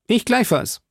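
put-on high, eager young musketeer; D'Artagnan. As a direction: chime in promptly, pitched well up. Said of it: "bemerkte D'Artagnan". (hohe, eifrige Stimme eines jungen Musketiers)

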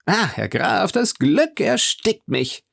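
high, stretched-out gushing; milk the joy, slow and high; overcome with happiness. Acted. Andrea spielt dem Grafen Sohnesglück vor. (überschwänglich glücklich, hoch und gedehnt)

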